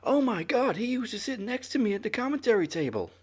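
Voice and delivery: exaggerated astonished outburst; mock shocked realization. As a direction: exclaim as a stunned commentator. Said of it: "Corey didn't say".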